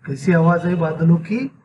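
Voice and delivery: usual speaking voice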